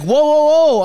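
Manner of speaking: loud, high whoa, whoa, whoa; alarmed shout. Retold or imitated. Passenger shouting at the driver to stop, alarmed. Retold yelling at Uber driver.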